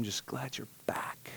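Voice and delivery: hushed, unvoiced whisper of relief. Jesus whispering welcome to the returning sinner. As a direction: breathe it like a secret. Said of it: "Jesus says".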